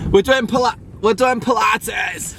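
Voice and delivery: high, loud, drawn-out Yorkshire holler; hammed-up Yorkshire cry; Yorkshire accent. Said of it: "say Pilates in a really Yorkshire accent".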